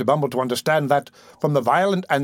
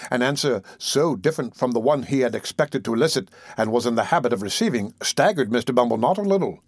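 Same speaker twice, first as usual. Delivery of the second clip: ordinary delivery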